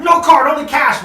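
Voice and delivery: loud, high mock refusal; imitating an emphatic vendor; barking donkey man. Donkey guys refuse his card.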